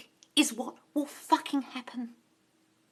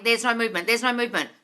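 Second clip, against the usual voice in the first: normal talking voice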